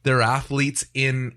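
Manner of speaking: ordinary talk, nothing marked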